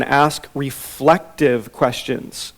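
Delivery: slow, weighty emphasis on "ask reflective questions"; slow emphatic. Shown as a demonstration. Teacher stating point one slowly. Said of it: "So, number one".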